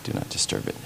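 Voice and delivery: breathy whisper to herself; muttered aside in a whisper. Real, not acted. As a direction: whisper it under the breath while fiddling. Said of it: "sorry, I'm gonna".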